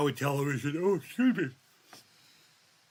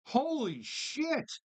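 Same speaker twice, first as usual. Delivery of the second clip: higher, slow stretched amazement; amazed disbelief; 10 semitones higher, much slower than usual. Real, not acted. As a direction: drag it out in shock. Repeating louder disbelief.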